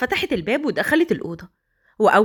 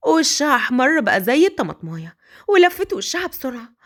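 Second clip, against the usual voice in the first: ordinary talk, nothing marked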